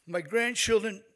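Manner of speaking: ordinary delivery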